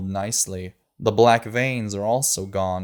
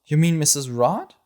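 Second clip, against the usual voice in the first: surprised; 6 semitones higher, 4 dB louder than usual. A narrator's reading. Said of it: "The girl looks a bit surprised".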